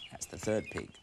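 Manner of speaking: plain reading voice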